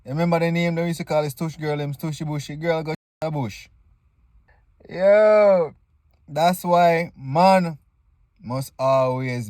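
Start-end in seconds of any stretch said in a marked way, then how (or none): none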